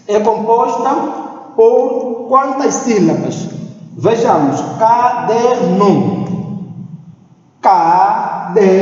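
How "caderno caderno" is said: "Caderno" slowly split into CA-DER-NO; syllable by syllable. Shown as a demonstration. Teacher counts the syllables of the word, slowly separated. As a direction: break "Caderno" into three drawn-out syllables. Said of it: "soletrar com mais calma".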